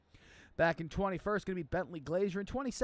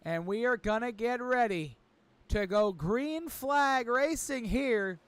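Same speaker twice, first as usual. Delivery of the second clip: hype announcer; 5 semitones higher, 4 dB louder than usual. Acted. Commentator hyping viewers before the green flag, booming announcer. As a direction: build the crowd up for the green flag.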